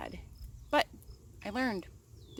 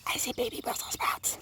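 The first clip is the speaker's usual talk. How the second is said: whispering. Real, not acted. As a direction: breathe the whole line with no voice, hushed. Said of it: "Shh, don't wake them up".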